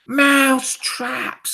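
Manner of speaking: high, drawn-out astonished cry; slow, loud witches' exclamation; witches crying out. A narrator's reading. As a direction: echo it as a long witchy cry. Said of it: "cried the witches".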